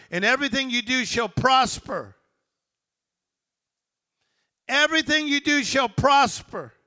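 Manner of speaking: loud, high-pitched, driving sermon delivery; rousing preacher building question on question; full-force preaching. Real, not acted.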